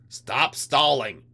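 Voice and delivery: loud, slow, stern command; angry order. Acted. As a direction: cut him off, slowly and hard. Alien liaison snaps.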